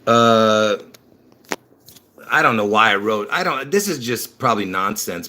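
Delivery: ordinary delivery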